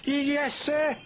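high, stammering yes sir; nervous stammer. Acted. Percy answering the Fat Controller nervously. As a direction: get it out nervously. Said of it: "he added nervously".

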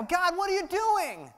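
high, strained whine at God; mock a frustrated toddler; whiny complaint to God. Preacher mimicking people whining at God. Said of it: "Anybody ever sound like that?".

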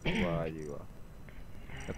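slow, low sarcastic "wow"; drawn-out mocking praise. Real, not acted. Sarcastic praise during argument.

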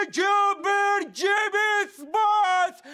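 high, drawn-out shout; excited announcement. Acted. Yuri proudly presenting the sports shop, triumphant drawn-out shout. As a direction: belt out the store name slowly. Showing off the store to Michael.

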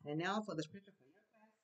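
usual speaking voice

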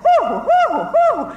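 high, loud back-and-forth whoops; volley the calls between two crowds; echoing hoo calls. Retold or imitated. Two halves of the crowd trading calls, high loud whoops. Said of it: "So you get".

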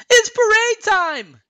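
very high, loud, drawn-out cheer; Olaf crying out excitedly. A narrator's reading. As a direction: shout it high in a cartoon voice, stretched out. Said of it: "he cried".

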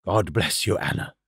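put-on very low old man's voice; old man, moved. A narrator's reading. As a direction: bless her low and with feeling. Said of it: "he cried".

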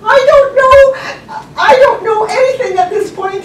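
high, loud mock wail; hammed-up helpless panic; frantic outburst. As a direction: shout it in flustered, laughing exasperation. Acted frantic answer to attendant.

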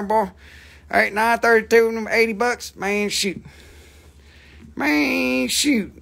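hammed-up country auctioneer accent; put-on drawl accent. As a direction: slur the patter in a mock country accent. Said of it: "all the accents".

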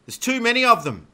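high, loud cry of panic; alarmed cry. A narrator's reading. Marco sees the wolves closing in, alarmed cry. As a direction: cry out as if overwhelmed. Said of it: "Marco cried".

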